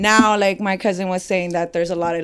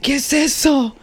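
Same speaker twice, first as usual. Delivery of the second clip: higher-pitched scolding in Spanish; mad mother; 6 semitones higher than usual. Retold or imitated. Guest mimicking her mother spotting the tattoo, mad. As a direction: mimic an angry mom demanding an explanation. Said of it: "she was really mad".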